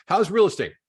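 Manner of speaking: high and loud, keen to talk business; eager question. Acted. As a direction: jump straight to business, eagerly. Friend asks in acted call.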